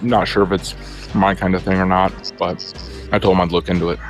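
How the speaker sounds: ordinary delivery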